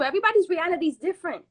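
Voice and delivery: ordinary delivery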